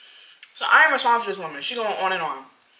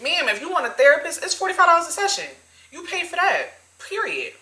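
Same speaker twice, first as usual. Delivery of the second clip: high-pitched, emphatic telling-off; sassy retort; 9 semitones higher than usual. Retold or imitated. Worker telling customer what therapy costs, sassy. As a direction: set her straight, voice pitched up.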